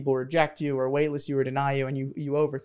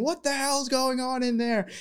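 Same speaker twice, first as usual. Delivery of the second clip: much higher, slow, hammed-up shout of confusion; mock bewildered dad; 10 semitones higher than usual. Dad in his office hearing the chaos, a mock baffled call. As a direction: play the baffled father calling from another room.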